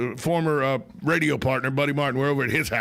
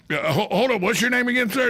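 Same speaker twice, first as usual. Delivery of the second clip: mock cop; 8 semitones higher than usual. [name] as cop questions caller.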